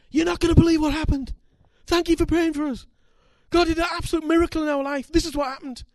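high, loud, fast excited read; joyful news. Retold or imitated. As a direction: burst with the good news. Reading the couple's joyful email.

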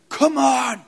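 high, loud shout of come on; desperate shout. Retold or imitated. Rescuer shouting at the man on the stair, desperate. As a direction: shout him to his feet.

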